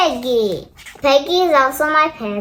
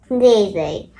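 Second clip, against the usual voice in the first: ordinary delivery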